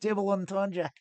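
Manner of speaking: amateur Australian take on double entendre; fake Aussie accent. Shown as a demonstration. Streamer repeating it for Charlotte, fake Australian accent. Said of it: "doing my fake Aussie accent for Charlotte".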